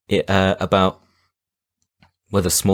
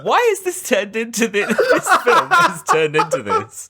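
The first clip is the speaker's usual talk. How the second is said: high squeal through real laughter; helpless laughing. Real, not acted. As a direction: barely get it out for laughing. Helpless laughter at their own tangent.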